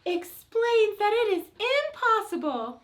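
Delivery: high, squeaky mouse voice. Pretend. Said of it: "little quiet mouse voice".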